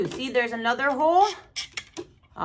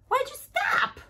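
teddy's voice; 13 semitones higher than usual. Pretend. Speaks for the teddy.

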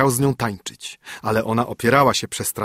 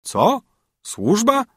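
much higher, mock astonished "Służba?" rising; incredulous; 12 semitones higher, 3 dB louder, much slower than usual. A narrator's reading. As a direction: scoff at the very idea of servants. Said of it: "odparł żebrak".

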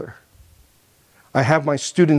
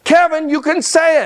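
higher, pressing nag at the pupil; pushy teacher; 6 semitones higher, 3 dB louder than usual. Retold or imitated. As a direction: scold the boy into saying it. Teacher pressuring Kevin in class.

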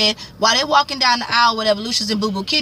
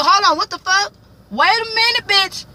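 much higher, indignant outburst; Cookie outraged; 10 semitones higher than usual. Retold or imitated. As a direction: stop everything, she's not having it. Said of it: "And Cookie like".

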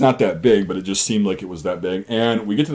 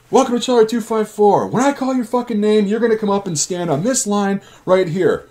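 high, projected sergeant over a bullhorn; bullhorn announcement; 11 semitones higher than usual. Retold or imitated. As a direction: announce through a bullhorn to the company. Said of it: "goes up with the bullhorn".

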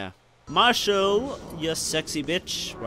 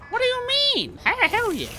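high excited squeal. Real, not acted. Gamer thrilled at the clearance level, high squeal. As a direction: pitch leaps up in delight.

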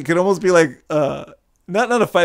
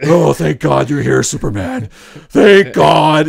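loud, gushing mock relief; hammed-up relief; 9 dB louder, slower than usual. Heroes greeting Superman's arrival, hammed-up loud relief. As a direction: cry out in exaggerated relief.

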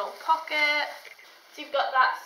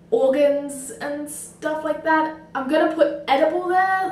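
usual speaking voice

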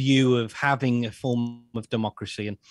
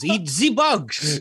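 mock villain slogan; 4 semitones higher, 3 dB louder, much slower than usual. Mocking Schwab-style slogan.

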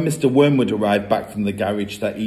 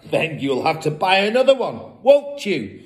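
mocking father; 6 semitones higher than usual. A narrator's reading. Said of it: "the father said".

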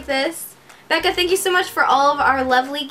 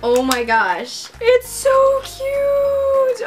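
high, slow, cooing over something cute; melting over a tiny charm; real gushing; 10 semitones higher than usual. Real, not acted.

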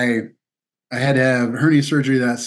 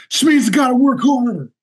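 imitating his younger self, much higher; mock gung-ho self-pep talk; stubborn resolve; 12 semitones higher, 4 dB louder than usual. Young athlete pushing himself to overtrain, mock determination.

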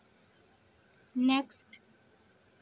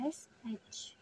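whispered letters; 11 dB quieter than usual. Shown as a demonstration. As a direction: whisper the letters S H, barely voiced. Teacher spelling out British.

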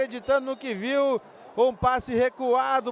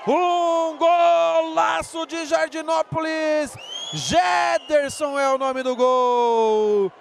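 higher, rising to a long goal scream; explosive goal commentary; 4 semitones higher, 4 dB louder, slower than usual. Real, not acted. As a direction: build into a stretched goal call. Goal build and call.

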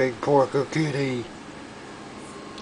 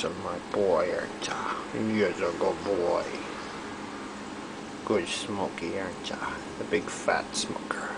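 whisper; 6 semitones lower, 9 dB quieter than usual. Real, not acted. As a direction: breathe it gently like a secret. Baby talk whispered to the cat.

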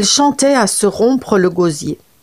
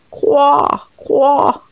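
higher croaks from the pond; frog croaking; 6 semitones higher than usual. Acted. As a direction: croak mournfully. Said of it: "les croissements douloureux des grenouilles". (coassements aigus)

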